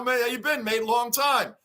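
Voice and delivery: normal talking voice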